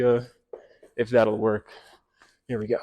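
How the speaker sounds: ordinary delivery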